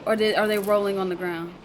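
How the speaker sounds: blurting out panic at the screen; frantic. Real, not acted. Fearing for the boys on the tracks, frantic and loud. Frantic reaction to train escape.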